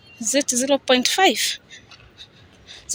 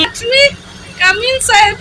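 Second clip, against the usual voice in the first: higher, loud, squealing with joy; bursting in delighted; excited squeal; 9 semitones higher, 4 dB louder than usual. Real, not acted. Squeals boarding the train.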